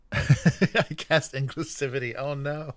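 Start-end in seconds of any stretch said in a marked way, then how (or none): none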